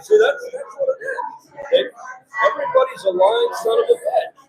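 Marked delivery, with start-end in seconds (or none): none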